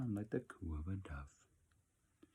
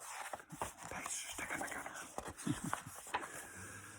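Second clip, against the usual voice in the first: whispered laugh; 9 dB quieter than usual. Real, not acted. Reader laughs softly between pages.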